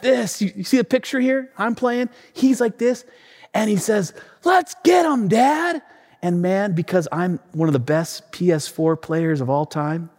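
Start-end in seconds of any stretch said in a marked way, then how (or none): 4.4-5.8 high, eager battle cry; rally dad like an excited kid; fired-up little boy. Retold or imitated. Son rallies dad to fight.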